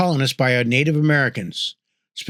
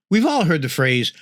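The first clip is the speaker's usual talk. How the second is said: normal talking voice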